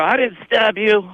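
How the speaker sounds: high, drawn-out mock protest; retold panicked denial. Retelling panicked denial to brother.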